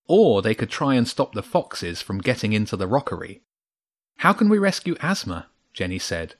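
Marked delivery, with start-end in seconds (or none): 4.2-5.5 high, anxious question; worried, pitched-up plea. A narrator's reading. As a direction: let worry sound clearly in the voice. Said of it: "worry sounding clearly in her voice".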